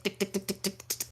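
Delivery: whispered tick tick tick; soft clock ticking. Retold or imitated.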